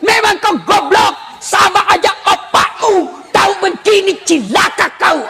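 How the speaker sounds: mock furious tirade; cursing wife. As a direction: lay into him, hammering each insult. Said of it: "tidak boleh maki-maki suami".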